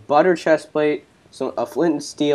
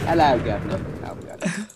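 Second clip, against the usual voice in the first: mock British greeting, lower pitched; bad British accent; 3 semitones lower, 7 dB quieter than usual. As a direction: put on a hammy British accent. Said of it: "I still had the British accent".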